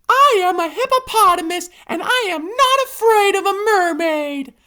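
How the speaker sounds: high hippo voice; bold hippo. A narrator's reading. Hippo to panda, high brave boast. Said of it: "the hippopotamus says".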